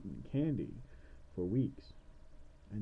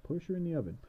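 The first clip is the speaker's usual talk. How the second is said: put-on kid voice; 6 semitones higher, faster than usual. Kid suggests pushing witch.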